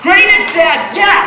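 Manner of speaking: high, loud, hammed-up victory cry; triumphant boasting. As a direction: crow it proudly to the crowd. Boasting about his shirt to crowd.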